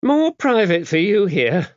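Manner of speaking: high-voiced, brisk, no-nonsense abbess; a worldly, gruff nun laying down the rules; gruff old abbess. A narrator's reading. Dame Tabitha briefing the visiting priest, gruff and brisk.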